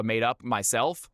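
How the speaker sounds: usual speaking voice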